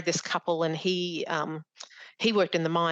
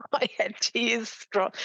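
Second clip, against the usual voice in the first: laughing through near-tears; teary laugh; 5 semitones higher, much slower than usual. Real, not acted. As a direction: voice lifting high with emotion.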